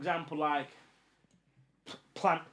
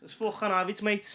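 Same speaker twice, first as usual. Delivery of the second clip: higher-pitched Scouse accent; 5 semitones higher, 4 dB louder than usual. Shown as a demonstration. Asked for accents to end the podcast, Scouse accent. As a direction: put on a quick Scouse accent bit. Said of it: "but I'm not Scouser".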